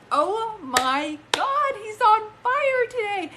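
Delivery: high, loud, delighted cry; thrilled outburst. Real, not acted. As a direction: burst out in amazed delight. Medium thrilled by dad's signs.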